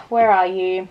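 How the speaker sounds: ordinary delivery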